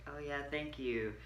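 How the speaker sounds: soft awkward giggling thanks; nervous laughing deflection. Retold or imitated. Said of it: "I'm just like".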